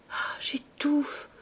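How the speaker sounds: breathless, high gasp; choking. A narrator's reading. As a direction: gasp it out slowly, as if suffocating. Dying girl says she is suffocating.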